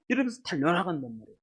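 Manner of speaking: usual speaking voice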